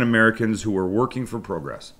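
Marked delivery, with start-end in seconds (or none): 1.4-1.9 "progress" with a long Canadian "pro" sound, like "proh-gress"; Canadian accent. Real, not acted. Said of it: "I slipped into my Canadian accent there".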